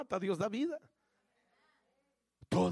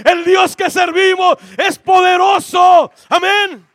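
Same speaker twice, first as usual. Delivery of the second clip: high, loud, slow preacher's cry; preaching shout; 10 semitones higher, 18 dB louder, slower than usual. Acted. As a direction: proclaim it at full force. Prêche à pleine voix.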